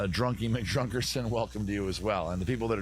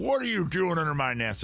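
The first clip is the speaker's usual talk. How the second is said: a higher, louder indignant dad bird; mock angry bird dad; 8 semitones higher, 4 dB louder than usual. Bird dad scolding Brian under his nest, mock indignant. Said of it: "Dad coming back going".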